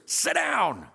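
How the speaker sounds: a teacher acting out a commander's barked order. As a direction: bark it like a military commander at troops. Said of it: "what a military commander would do".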